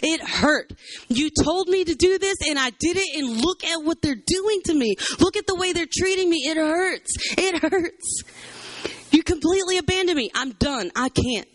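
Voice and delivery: high, anguished protest to God; hurt outpouring. Acted. Jeremiah showing God how they treat him, hurt pleading. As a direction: plead it out, acting wounded and worn down. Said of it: "He's being completely real with Jesus".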